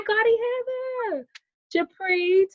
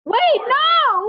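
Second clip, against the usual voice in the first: sudden high loud outburst of disbelief; surprised cry; 11 semitones higher, 10 dB louder than usual. Real, not acted. Birthday girl realizing the party is today, surprised yell. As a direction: yell it out, caught totally off guard.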